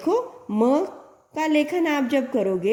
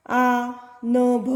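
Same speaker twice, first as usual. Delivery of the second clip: आ, न भ read very slowly, sound by sound; slow letter reading; much slower than usual. Shown as a demonstration. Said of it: "तो देखो बच्चों". (अक्षर धीरे-धीरे पढ़कर दिखाना)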